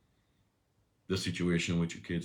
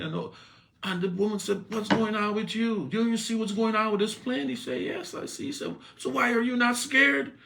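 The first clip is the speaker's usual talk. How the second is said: higher, louder story-sermon voice; raised storytelling preaching; 12 semitones higher, 5 dB louder than usual. Acted. Preacher telling the church about the boy on the plane, excited and raised. Story told high and loud.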